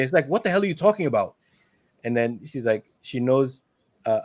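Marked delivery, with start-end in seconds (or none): 0.3-1.3 high, fast mock denial; rushed, raised-pitch retold protest; indignant denial. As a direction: imitate someone hotly denying it. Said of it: "And Rod is denying it".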